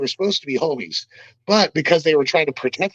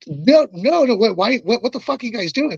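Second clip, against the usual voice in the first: higher, rushed protest that stumbles over itself; retold panicked denial; 7 semitones higher than usual. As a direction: sputter the denial in alarm. Retelling panicked denial to security.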